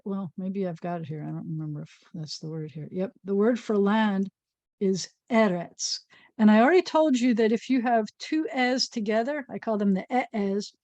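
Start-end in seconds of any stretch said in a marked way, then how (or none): 4.8-6.0 "eretz" said slowly, stress on the first e. Shown as a demonstration. Teacher modelling Hebrew stress for children, slow. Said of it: "the accent's always gonna be on the first e".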